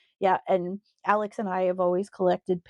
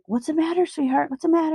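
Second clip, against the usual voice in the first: put-on higher, sing-song baby talk to the dog; cooing to a hurt puppy; 9 semitones higher, faster than usual. Owner cooing to her hurt puppy, high and tender. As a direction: coo over the crying puppy, worried and sweet.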